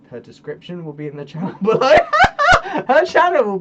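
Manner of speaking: loud, laughing at own slip; breaking into laughter. Real, not acted. Fluffing the outro plug, breaking into laughter. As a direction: crack up as the words come out.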